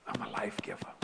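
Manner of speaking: soft low whisper; quiet solemn whisper; hushed. Acted. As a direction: say it softly to yourself.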